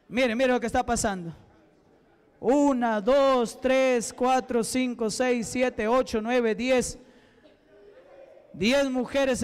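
ordinary talk

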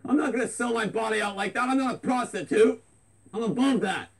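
a touch high, indignant refusal. Real, not acted. Vlogger refusing the caller's suggestion, mildly indignant. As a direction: draw himself up and refuse.

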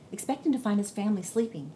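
usual speaking voice

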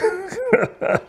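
short squeaky burst of laughter; high-pitched laugh. Real, not acted. Laughs at mention of She-Hulk.

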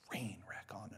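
breathy whispered admission; sheepish whisper. Real, not acted. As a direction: drop to a whisper on it. Self-deprecating aside.

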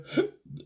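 sharp sudden hiccup; a sharp involuntary hiccup. Real, not acted. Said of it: "I got the hiccups and everything".

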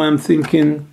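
ordinary delivery

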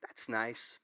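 polite dismissal. Retold or imitated. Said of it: "nods and listens politely".

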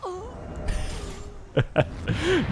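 breathy laugh with very high peaks; high wheezy laugh. Real, not acted. Player amused that everyone flees, high breathy laugh. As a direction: crack up at the fleeing players.

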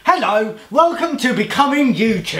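a hint of a bright channel intro; Ben Cook impression. Friend game, greeting like a youtuber, lightly voiced. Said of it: "Ben Cook".

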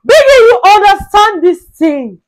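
very high, loud wailing protest; loud, high-pitched disbelief; outraged outcry. Acted. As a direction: cry out in hammed-up indignation. Mock outrage at Biggie's remark.

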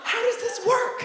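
very high, bewildered yelp on What; shrieking confusion. Retold or imitated. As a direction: squeal it like the ad broke your brain. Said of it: "I'm thinking like".

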